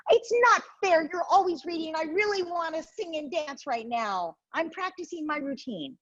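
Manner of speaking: put-on high, complaining kid voice; whiny little brother. Little brother protesting to his mother, high and whiny. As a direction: sulk like a boy who wants to dance. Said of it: "And he says".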